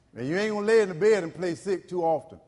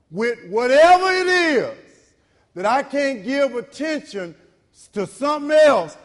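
preaching climax; 6 semitones higher, 7 dB louder than usual. Acted. Preacher builds to a loud climax.

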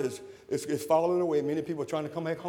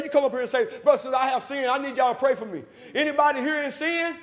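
raised, urgent appeal pitched high; preacher's altar call; 8 semitones higher, 5 dB louder than usual. Real, not acted. Preacher inviting those in sin up for prayer, altar call. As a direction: call them forward from the pulpit. Calls sinners forward for prayer.

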